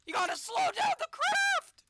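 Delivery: very high, loud shriek; panicked scream. Real, not acted. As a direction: shriek at him to slow. Panicking as the capsule falls.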